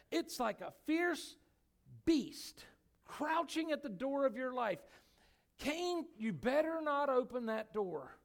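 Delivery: high, loud preaching build; fiery preaching. Acted. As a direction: preach it with fire to the congregation. Preaching at full force.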